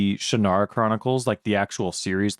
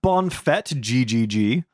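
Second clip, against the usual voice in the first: lightly put-on bad French accent; a deliberately terrible French accent, barely done; mock French accent, hammed up but faint; horrible French accent; slower than usual. Shown as a demonstration. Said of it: "That's my intentionally horrible French accent".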